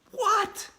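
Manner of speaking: high indignant whine; complaining. A narrator's reading. Boy protesting the quiz host, high indignant complaint. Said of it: "I complained".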